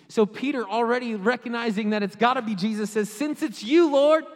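loud, high, sustained sermon fervor; full-force preaching. Real, not acted. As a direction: build urgency and keep pushing.

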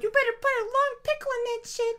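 very high, squeaky put-on voice; mock baby voice. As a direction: play a demanding baby in falsetto. Acting out talking newborn baby bit.

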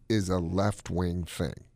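usual speaking voice

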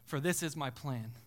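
lightly voiced God, quietly laying down the order; God's firm refusal. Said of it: "And God said".

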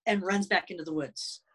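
usual speaking voice

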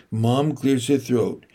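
ordinary delivery, nothing marked

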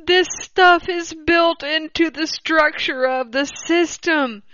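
losing it laughing. Real, not acted. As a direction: try to read on, then crack up. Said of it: "I hope you're laughing at this as much as I am".

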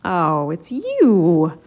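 slow, scornful sneer. A narrator's reading. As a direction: drag it out with contempt. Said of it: "Paul sneered".

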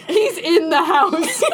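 mock terrified. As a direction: hiss out the danger.

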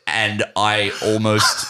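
ordinary talk

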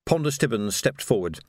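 usual speaking voice

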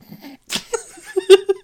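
high giggling laugh; high giggle. Real, not acted. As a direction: burst into giggles. Laughing at hard and deep.

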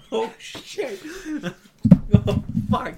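high, laughing mock panic. Acted panic between laughs.

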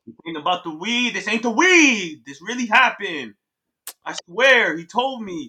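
loud, urgent insistence; agitated, emphatic denial; insistent protest. Acted. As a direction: insist you are telling the truth. Insisting against roommate's weed theory.

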